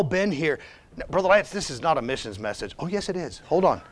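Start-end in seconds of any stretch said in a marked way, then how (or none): none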